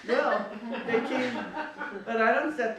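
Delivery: laughing through the words. Acted. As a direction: chuckle as you tell it. Laughs recalling the teasing.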